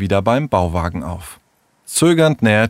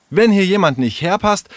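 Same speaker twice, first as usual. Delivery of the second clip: high-pitched, accusing attack; quarrelsome; 9 semitones higher than usual. Acted. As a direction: turn on her, scolding. Tessa turns on Sarah in quarrel. (streitlustig, mit hoher Stimme)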